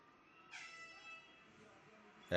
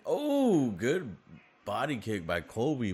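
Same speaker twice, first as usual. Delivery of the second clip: high, loud oh easing into praise; pitched-up fight-fan reaction; excited call; 6 semitones higher, 6 dB louder, slower than usual. Real, not acted. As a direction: call a clean kick as it lands. Reacts to a body kick.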